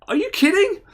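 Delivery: mock-astonished squeak of a question; incredulous. Man reacting to a scammer's death threat, incredulous.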